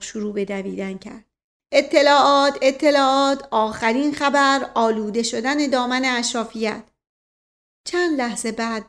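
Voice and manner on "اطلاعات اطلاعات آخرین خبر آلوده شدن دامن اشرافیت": loud street hawker calling out the headlines; newspaper boy cry. Pretend. Uncle mimics a newspaper seller, shouting loud. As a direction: bellow the news like a paperboy selling papers.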